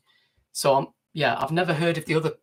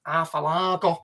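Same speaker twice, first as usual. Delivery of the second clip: put-on Lancashire accent; 2 semitones higher, slower than usual. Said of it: "when I used to work in a pub".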